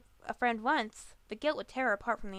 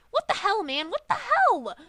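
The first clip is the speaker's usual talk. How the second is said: much higher, louder furious yell; angry yelling; 12 semitones higher, 9 dB louder than usual. A narrator's reading. Griffin rages at her friend, furious yelling. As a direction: explode in rage. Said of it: "The Griffin angrily paced".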